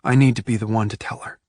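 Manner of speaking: ordinary delivery, nothing marked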